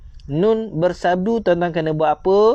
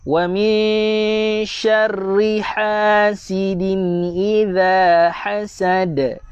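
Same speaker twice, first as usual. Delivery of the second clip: slow, higher, drawn-out tajwid recitation; Quran recitation; 4 semitones higher, much slower than usual. Shown as a demonstration. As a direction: chant each syllable slowly for students to follow. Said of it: "ayat yang kelima saya baca". (bacaan tartil perlahan)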